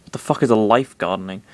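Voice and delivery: ordinary delivery, nothing marked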